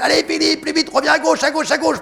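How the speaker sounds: steer him left by shouting; Jacques yells directions. Retold or imitated. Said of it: "Jacques, il gueule". (Jacques gueule la direction, cri aigu)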